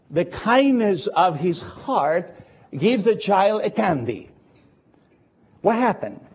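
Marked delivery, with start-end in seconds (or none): none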